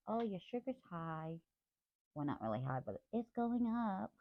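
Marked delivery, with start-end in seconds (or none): 0.1-1.4 device voice. Retold or imitated. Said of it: "it kinda went off saying".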